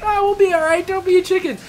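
very high, hammed Jamaican accent; cartoon diver telling the kids not to be chickens, in a Jamaican accent; mock Jamaican diver. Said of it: "he's like".

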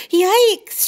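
high loud yelp of surprise; startled yelp. A narrator's reading. Brooke startled by an owl above, loud yelp. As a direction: jump at the sight. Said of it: "she exclaimed".

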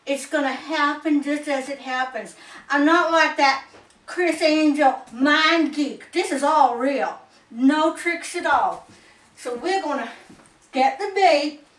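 normal talking voice

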